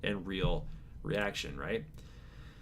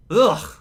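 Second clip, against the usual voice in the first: ugh, a loud pained groan; real loud groan at bad news; 11 semitones higher, 13 dB louder than usual. Real, not acted. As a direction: let out a big groan of dismay. Groaning at a survey complaint.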